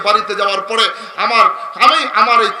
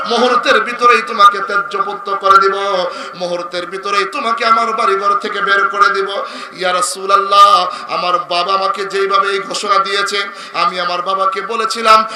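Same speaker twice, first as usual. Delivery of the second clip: melodic preaching; 7 dB louder than usual. Real, not acted. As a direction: chant the story, swelling into loud cries. Preacher tells story in melodic chant. (সুরেলা ওয়াজের টানা গলা)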